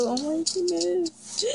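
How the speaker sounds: high, slow excited squeal. Real, not acted. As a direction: squeal with real delight. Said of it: "I'm really excited".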